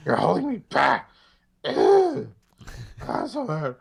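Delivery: hammed-up young man's tantrum; Hayden Christensen. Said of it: "Hayden Christensen's normal voice".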